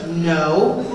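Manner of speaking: a mama shutting it down low; mother's flat no. Retold or imitated. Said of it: "And she goes".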